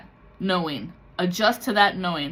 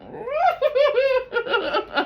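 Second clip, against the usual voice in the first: mocking laugh; 5 dB louder than usual.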